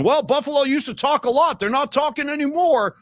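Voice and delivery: lightly voiced gloating coach; a hint of a coach taunting the other team after a win; mock smug coach. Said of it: "he was, like, saying".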